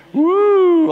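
a loud, high whoop of amazement; excited whoop. Real, not acted. Preacher whooping at a debt of millions, loud and high. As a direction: whoop like the number just hit you. Preacher reacts to huge sum.

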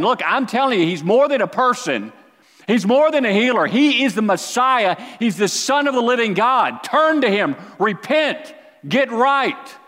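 loud, high preacher's cry; fervent proclamation. Acted. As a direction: announce it like a herald to the crowd. John proclaiming the Messiah.

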